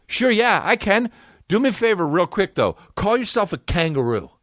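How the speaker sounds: high, snide retort; snide imagined comeback; sarcastic comeback. Retold or imitated. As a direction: shoot back a mocking dare. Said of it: "that made me mad".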